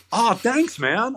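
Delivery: high-pitched, acted-out gratitude; pleased thanks. Band member retelling his reply to the manager's remark, pleased thanks. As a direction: imitate being happily flattered. Retold delighted reply to a compliment.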